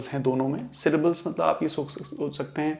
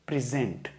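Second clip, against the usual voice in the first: Present stressed on ZENT, pri-ZENT; stress on second syllable; 2 semitones lower, 6 dB quieter than usual. Shown as a demonstration. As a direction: put the emphasis on the last part of present. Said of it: "इस portion पे".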